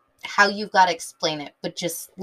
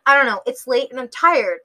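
usual speaking voice

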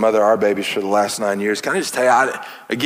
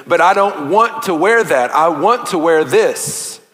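put-on slow, higher and louder kid's whine; whiny child; 4 semitones higher, 5 dB louder, slower than usual. Child refusing clothes, slow mock whine. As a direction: drag it out like a pouting child. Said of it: "and they said".

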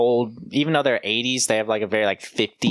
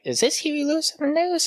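very high-pitched question; curious self-quote. Retold or imitated. Recalling hearing a song at the store, high-pitched wondering. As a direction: ask it way up in pitch. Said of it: "where I was like".

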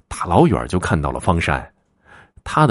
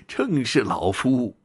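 hearty chuckle, then slow high reply; old man laughing; 6 semitones higher, 6 dB quieter, slower than usual. A narrator's reading. Old man confirms who he is to the visitor, laughing. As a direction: chuckle first, then draw out the words as an elder. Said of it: "老者捋着胡须笑道".

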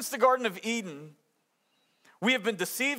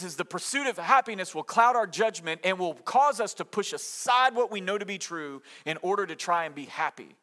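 normal talking voice

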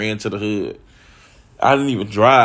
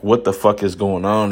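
ordinary delivery

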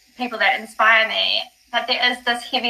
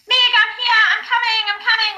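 excited shout; 11 semitones higher than usual. Retold or imitated. Running down the stairs, shouting high to her friend. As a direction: yell it up high as you run. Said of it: "And I was like".

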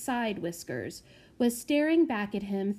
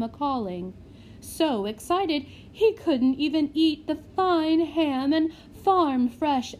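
fawning matron; 2 semitones higher, 4 dB louder than usual. Acted. Matron flattering the lord.

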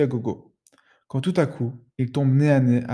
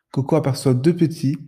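ordinary delivery, nothing marked

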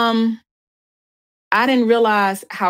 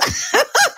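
laugh. Real, not acted. Laughs at herself.

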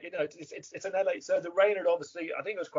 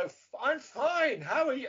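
high, drawn-out swooping reply; caught sing-song tune; 9 semitones higher, slower than usual. Retold or imitated. Actor catching his co-star's tune in reply, high sing-song. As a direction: copy his exaggerated lilt, slowly. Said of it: "hard not to pick up on his kind of tune".

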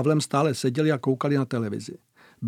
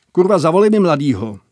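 loud barked order; 3 semitones higher, 7 dB louder than usual. A narrator's reading. Bogus orders the duty soldier.

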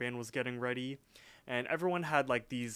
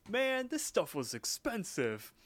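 high, slow, drawn-out cartoon lament; mock whining complaint; 9 semitones higher than usual. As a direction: imitate a character moaning over wasted money. Retold complaint about ruined product.

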